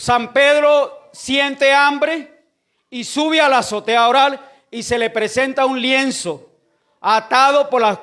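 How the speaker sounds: loud preacher's delivery with drawn-out key words; preaching. Real, not acted. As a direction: proclaim the Bible story to the congregation. Preaches the story loudly.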